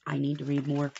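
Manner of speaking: ordinary delivery, nothing marked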